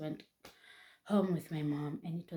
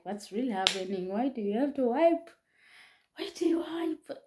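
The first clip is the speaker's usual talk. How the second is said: rising, louder, higher-pitched protest; retold upset questioning; 7 semitones higher, 7 dB louder than usual. Girlfriend asks why he wiped her kiss, upset. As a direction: demand an explanation, voice climbing as you go.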